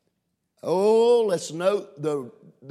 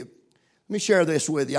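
ordinary talk

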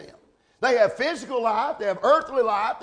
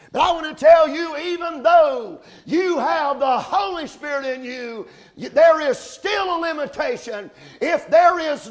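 raised, emphatic pulpit delivery; forceful preaching; 4 semitones higher, 5 dB louder than usual. Real, not acted. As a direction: preach it hard, punching the key words. Preacher building to forceful climax.

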